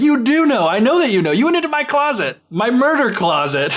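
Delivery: high, emphatic retold accusation; mock accusing. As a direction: confront her in mock outrage. Retold husband accusing his wife.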